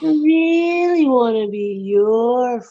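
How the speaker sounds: very slow, stretched fake sincerity; patronizing, sarcastic. Second guy pleads with departing Derek, sarcastic. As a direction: drag out every word with mock longing. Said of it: "patronizing and sarcastically".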